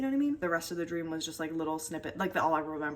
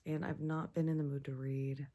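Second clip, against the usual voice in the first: normal talking voice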